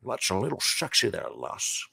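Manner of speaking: a playful Scottish Connery purr; Sean Connery impression. Flirting with a chatter as Connery, Scottish burr. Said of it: "my attempt at Sean Connery".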